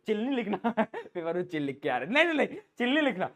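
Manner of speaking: usual speaking voice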